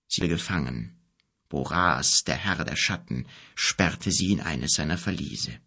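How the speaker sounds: a low-voiced wise wizard explaining gently; old wizard. A narrator's reading. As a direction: speak as the grave old wizard, low and calm. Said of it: "wizard tells grave news".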